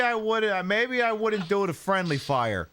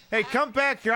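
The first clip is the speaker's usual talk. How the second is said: higher call after someone leaving; 7 semitones higher than usual. Real, not acted. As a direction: shout after him to come back. Calling after a fleeing fish.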